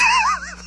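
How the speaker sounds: high-pitched burst of laughter; real laugh at the punchline. Real, not acted. Laughs at his friend's retold punchline.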